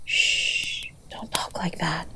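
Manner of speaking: hushed, tender whisper; soothing whisper. Acted. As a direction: hush them gently, barely voiced. Hushing the partner's dark talk.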